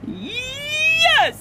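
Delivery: high, stretched shout; stubborn cashier shouting, laughing; shouted yes. Acted. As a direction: bellow it back. Cashier in back-and-forth quarrel.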